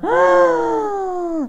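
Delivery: sudden very high ah; startled squeal; high exclamation. Real, not acted. Tarot reader at the drawn card, high startled ah.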